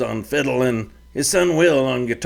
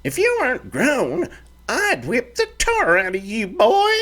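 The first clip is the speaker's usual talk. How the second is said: put-on high, fiery old ranch mother; stern mother's threat, pitched high; scolding mother; 13 semitones higher than usual. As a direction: scold your grown boy like a fiery mama. Mother scolds her son.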